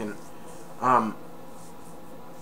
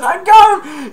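high, loud cry of victory; triumphant shout; 14 dB louder, slower than usual. Retold or imitated. Marv catching Kevin, high triumphant yell. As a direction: yell it as you grab the kid. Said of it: "Marv grabbed Kevin as he was running and said".

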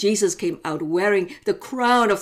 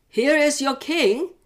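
slow, higher proclamation; presenting governor; 8 semitones higher, 5 dB louder, slower than usual. Acted. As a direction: present him slowly, with a sneer. Pilate presents Jesus.

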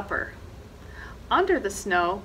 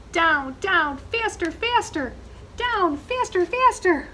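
excited rush; 7 semitones higher, 4 dB louder than usual. A narrator's reading. Skiing downhill, speeding up.

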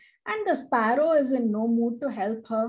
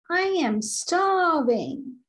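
higher, drawn-out cry of a hungry little creature; starving millipede; 6 semitones higher than usual. Acted. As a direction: whine it like a tiny starving bug.

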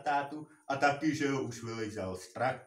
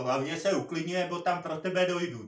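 heated retort, raised and louder; yelling back; 6 semitones higher, 6 dB louder than usual. Retold or imitated. Fed-up worker threatening the tractor driver, angry yell. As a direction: shout a warning back at him. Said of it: "že jsem normálně zařval".

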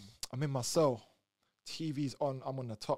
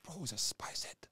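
whispered, softer reply; whispered explanation; 6 dB quieter than usual. Retold or imitated. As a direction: friend quietly explaining the spice head. Friend explaining quietly.

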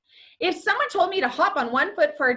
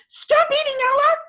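high, loud, urgent order; commanding shout; 14 semitones higher, 7 dB louder, faster than usual. A narrator's reading. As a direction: shout a mother's sharp command. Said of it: "she commanded".

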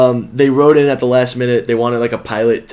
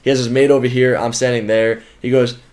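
ordinary talk, nothing marked

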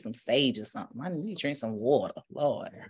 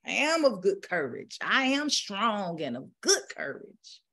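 preacher urging; 6 semitones higher than usual. Retold or imitated. Preacher urging the congregation to affirm boldly. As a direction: push the congregation to say it with their chest. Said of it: "basically he was saying".

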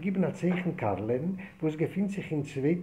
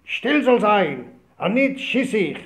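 soldier's order; 5 semitones higher, 8 dB louder than usual. Retold or imitated. Soldier Karl barking orders.

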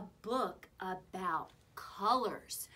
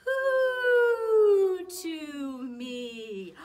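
loud, high, long-drawn hoo; high owl call sliding slowly down; owl hoot; 10 semitones higher, 13 dB louder, much slower than usual. Acted. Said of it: "A friendly owl saying".

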